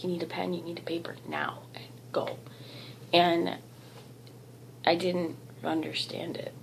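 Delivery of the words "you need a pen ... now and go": unvoiced urgent whisper. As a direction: whisper it like a command. Spirit message retold.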